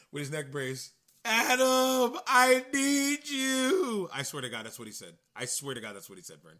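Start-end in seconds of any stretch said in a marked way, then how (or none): 1.2-4.1 high, loud, very slow plea; pleading dramatic cry. Retold or imitated. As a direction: call out desperately, drawn out. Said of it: "Roddy was like".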